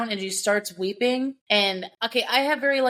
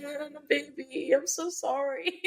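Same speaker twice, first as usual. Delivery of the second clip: put-on high, soft baby talk, a touch of laughter; tender mock sympathy; cooing pity; 6 semitones higher, 5 dB quieter than usual. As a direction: coo at him like a sad puppy. Cooing at the character.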